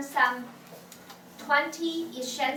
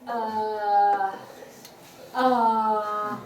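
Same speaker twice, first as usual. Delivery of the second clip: "uh" held long and low; low third tone; 4 semitones lower than usual. Shown as a demonstration. Can't remember yesterday's lunch, low hesitating "uh". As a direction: drag out a low, level "uh". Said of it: "That's third tone".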